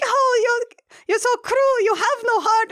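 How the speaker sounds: high put-on wailing brides; high hammed-up lament; mock brides. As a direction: whine like wounded vampire brides. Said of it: "they're like".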